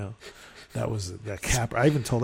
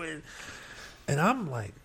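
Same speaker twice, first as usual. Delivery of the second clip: normal talking voice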